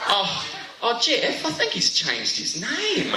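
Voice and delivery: high, slow puzzled mum voice; confused mum. Retold or imitated. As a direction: mum calling to dad, bewildered. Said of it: "my mum goes".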